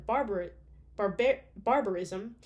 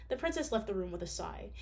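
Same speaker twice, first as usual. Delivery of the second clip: plain reading voice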